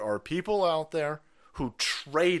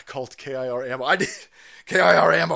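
ordinary talk, nothing marked

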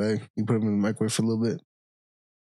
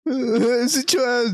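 high-pitched laughing squeal; 14 semitones higher, slower than usual. Real, not acted. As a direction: crack up while asking. Teasing line, crying laugh.

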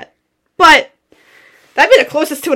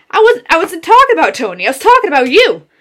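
raised, louder voice pushing back hard; angry retort; 5 semitones higher, 7 dB louder than usual. Retold or imitated. To her manager Hillary, a loud angry retort.

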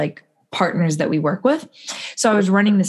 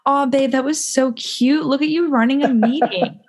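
high, slowish gushing praise; mock doting boyfriend; 7 semitones higher than usual. Ex-boyfriend praising her from the couch, a light sweet coo. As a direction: coo over her like a proud partner. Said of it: "my ex goes".

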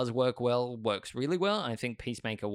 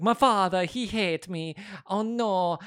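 high, louder mock Italian whine; Jared Leto's bad Italian accent; 8 semitones higher, 4 dB louder, slower than usual. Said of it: "He's like".

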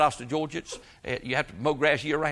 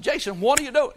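much higher, exasperated retold yell; angry yell; 9 semitones higher than usual. Pastor yelling at the teen wrecking the pecans, angry. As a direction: bark it at the boy in disbelief.

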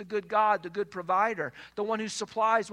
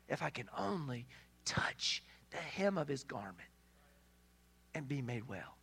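soft, whispered, hopeful inner voice; hushed whisper; 8 semitones lower, 12 dB quieter than usual. Acted. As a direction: whisper it like a secret hope. Voicing the sick woman's hope.

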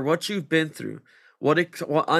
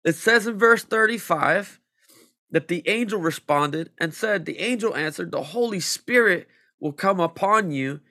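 higher, louder building sermon; fervent preaching; 5 semitones higher, 4 dB louder than usual. Acted. Preacher rousing the congregation with Mary's story. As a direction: drive it home to the congregation.

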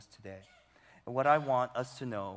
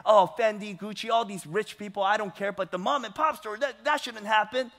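mock indignation; 7 semitones higher, 5 dB louder than usual. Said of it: "you get it".

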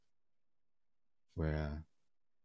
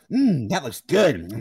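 slow, loud, higher creep impression; hammed-up ogling over food; Stamper; 7 semitones higher, 12 dB louder, slower than usual. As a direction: drag out the mmm with exaggerated relish. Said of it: "that was like that".

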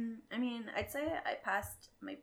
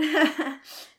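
burst of laughter; 9 semitones higher, 16 dB louder than usual. Real, not acted. Laughs after quote.

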